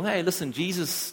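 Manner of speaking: ordinary talk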